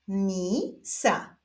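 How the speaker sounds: stretched first syllable, pause, then second; drawn-out, divided syllables; syllable reading. Shown as a demonstration. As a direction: read it slowly as two separate syllables. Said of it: "doczytujemy sylabę różową".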